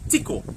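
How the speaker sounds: "tiko" said slowly and clearly, tee-ko; model word tiko. Shown as a demonstration. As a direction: model "tiko" for the kids to repeat. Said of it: "if you say that back to me".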